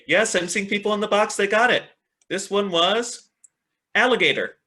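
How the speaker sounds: upbeat teacher cheer; excited praise. Real, not acted. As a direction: celebrate the right answers. Students guessed the word.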